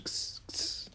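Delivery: hissed ks ks, breathy and soft; whispered ks calls. Acted. The farmhand teasing the lawyer with a soft ks ks hiss. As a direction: tease the monkey with a whispered hiss. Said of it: "tout en criant".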